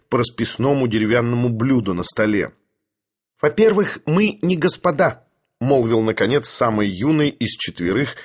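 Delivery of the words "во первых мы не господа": high, indignant objection; youthful protest, pitched high; indignant retort. Acted. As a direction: object sharply, voice jumping up. Young visitor objects.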